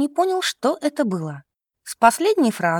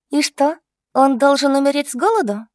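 higher-pitched defiant comeback; retorting; 5 semitones higher, 4 dB louder than usual. A narrator's reading. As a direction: fire back at big sister. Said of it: "парировала сестрёнка". (дерзко парируя)

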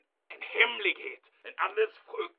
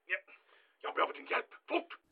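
urgent whisper; 6 dB quieter, faster than usual. Acted. Urgent secret night call.

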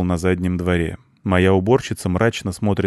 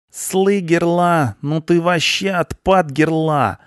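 high, drawn-out punk voice; stunned punk; 11 semitones higher, 4 dB louder, slower than usual. A narrator's reading. Punk dazzled by the blonde, stunned and drawling. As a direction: stretch the slang out in high amazement. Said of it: "сказал обалдевший панк".

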